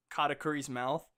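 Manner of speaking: ordinary talk, nothing marked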